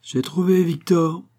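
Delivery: slow, high exclamation of victory; almost triumphant cry. A narrator's reading. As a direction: cry it out drawn-out, nearly triumphant. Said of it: "d'un ton presque victorieux".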